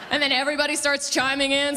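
ordinary talk, nothing marked

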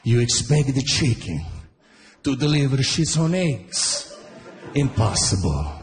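lightly voiced Italian dad; a hint of Italian accent, lecturing. Dad lecturing young Frank in a light Italian accent. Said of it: "It's like".

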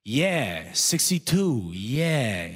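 put-on vague old sidekick echoing along; hammed-up senile echo; senile older guy. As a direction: play the clueless older ad-libber, swooping on each word.

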